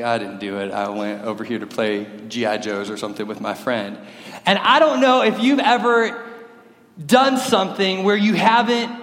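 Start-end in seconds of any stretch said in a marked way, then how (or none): 0.0-4.0 put-on low, subdued child confessing to mom; meek, mumbled excuse to a parent; sheepish kid's excuse. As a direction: own up quietly, dropping low and soft.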